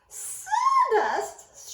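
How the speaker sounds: very high, loud shriek; high shocked mouse shriek. A narrator's reading. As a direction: shriek in horror.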